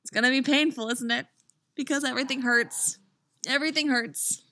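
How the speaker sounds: high-pitched playful lament; mock lament. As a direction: whine it high, as a joke.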